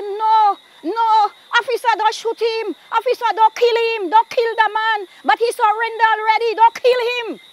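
high, urgent re-enacted begging; imitated frantic plea, insistent and high; desperate pleading. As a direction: beg the officer, pitch pushed way up. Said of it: "Flora is saying that".

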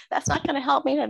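put-on high, whiny kid's refusal; high, stubborn child's complaint; mimicking upset son. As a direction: pitch up and play the stubborn child. Son refusing, voiced by mother.

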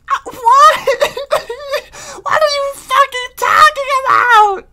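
very high, crying cartoon lady; panicking Screen Lady. Screen Lady panics about making pizza, high crying wail.

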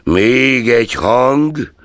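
a touch of a cold employer's warning; stern warning. A narrator's reading. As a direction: draw each word out as a threat. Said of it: "intette Scrooge".

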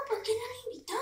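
breathy whisper as the dog. Pretend. Speaking for the puppy, a whispered complaint.

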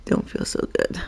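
faint, breathy sick murmur; unwell murmur. Real, not acted. Gamer with a headache, feeling unwell murmur. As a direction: sigh it out like you feel ill. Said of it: "Oh, I got a headache".

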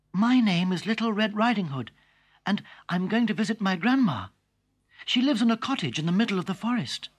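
put-on bright high girlish voice; chirpy trusting child; cheerful little girl. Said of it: "smiled back at him and said".

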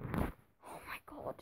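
slow whispered disbelief; exasperated whisper. Real, not acted. Gamer scanning bad transfer options, slow whisper. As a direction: breathe it out, fed up.

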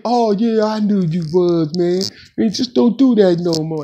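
lightly voiced buddy gloating a little; imitating smug homeboy. Friend smugly accepting lie.

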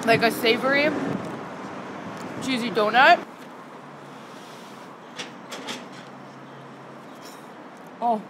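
chewing while talking; talking between bites; mouth full. Real, not acted. Seen 4: bitten cheese ball in hand, lips moving, mouth full while talking.

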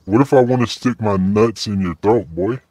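usual speaking voice

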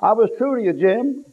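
high-pitched mock plea; acted pleading, pitched well up. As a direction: retell it as an earnest, high-voiced plea.

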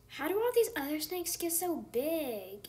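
usual speaking voice

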